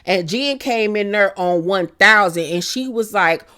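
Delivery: ordinary delivery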